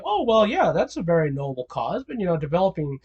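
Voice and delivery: a hint of a careful pharmacist; lightly voiced pharmacist. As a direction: pharmacist gently raising the cost, quietly. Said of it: "the pharmacist will be like".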